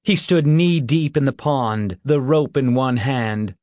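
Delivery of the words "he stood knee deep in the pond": ordinary delivery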